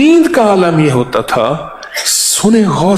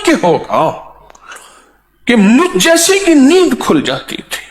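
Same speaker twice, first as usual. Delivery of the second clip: much higher, crying-out preacher voice; impassioned preaching; 10 semitones higher than usual. Acted. Preacher marvelling at the saints' weeping. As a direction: cry it out in awe. Emotional high pitched preaching.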